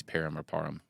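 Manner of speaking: Parham first as PAIR-um, then as PAR-um; first Parham like "pair", second like "par"; two pronunciations contrasted. Shown as a demonstration. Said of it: "how does somebody know".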